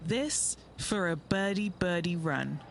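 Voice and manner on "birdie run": normal talking voice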